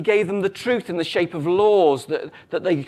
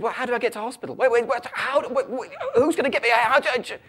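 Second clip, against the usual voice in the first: higher, stammering comic panic; panicking husband; 6 semitones higher, faster than usual. Acted. Husband hunting for a hospital in Rome, mock panic. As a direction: flail for words in mock panic. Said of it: "The husband panics".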